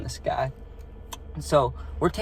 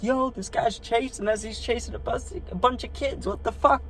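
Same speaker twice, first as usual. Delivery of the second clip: high, urgent, outraged pleading; half-panicked plea to a stranger; alarmed call out; 10 semitones higher, 3 dB quieter than usual. Retold or imitated. Kids in the car shouting to the jogger for help.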